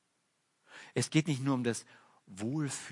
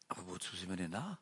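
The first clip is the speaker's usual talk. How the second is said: quiet question; 4 semitones lower, 8 dB quieter than usual. Shown as a demonstration. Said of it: "preacher repeats the question softly".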